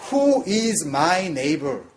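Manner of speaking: "Who is my neighbor?" very slowly, falling from "who"; slow English gloss. Shown as a demonstration. Preacher slowly translating the question into English. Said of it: "라고 한다면".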